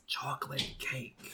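hushed whisper, slow; whispering a secret password. Acted. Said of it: "and I whisper the, the password to everyone".

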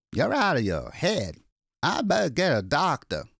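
a gas station man sizing up a stranger; suspicious attendant. Acted. Attendant questions ragged stranger, suspicious and wary.

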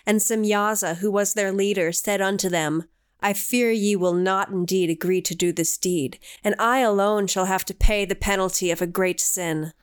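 solemn scripture reading. Acted. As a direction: intone it like ancient scripture. Reads scripture aloud.

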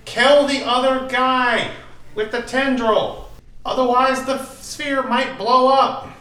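high, drawn-out battlefield yell; loud, urgent shout to allies; screamed warning. Acted. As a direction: scream orders across a battle. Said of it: "I'm gonna scream out".

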